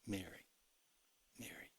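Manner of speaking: hushed loving call, nearly whispered; soft tender name. Retold or imitated. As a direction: breathe the name gently, almost a whisper. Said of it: "One word, Floyd".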